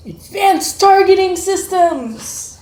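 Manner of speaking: high, loud, slow movie-trailer announcement; dramatic announcer voice. Pretend. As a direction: boom it out like a sci-fi trailer. Parent hypes robot, dramatic announcement.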